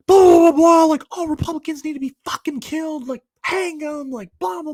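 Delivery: high, loud mocking rant of an angry guy; imitating a ranting yell. Mocking the ranting guy at the dorm coffee shop, high shouted rant. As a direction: mimic a crazed guy yelling about killing people. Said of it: "And he was just yelling".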